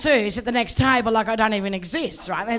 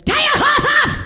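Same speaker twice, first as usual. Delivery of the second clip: much higher, loud bossy shout; masseuse barking an order; 7 dB louder than usual. Retold or imitated. Masseuse orders the stunned client, loud high shout. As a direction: bark it like a tiny commanding masseuse. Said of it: "gets in really close and goes".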